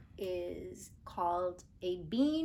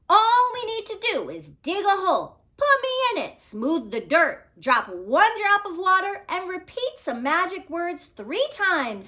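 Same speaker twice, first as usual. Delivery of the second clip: a touch higher, bright instructing bean; a small bean bossing the plan with glee; eager magic bean; 10 semitones higher, 8 dB louder than usual. Acted. Magic bean tells Jack how to plant it, eager bean voice.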